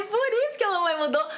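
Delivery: high, loud, still laughing, telling her son why she changed; amused high retort. Retold or imitated. Mother answers her son, high and amused. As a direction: toss it back to him with delight.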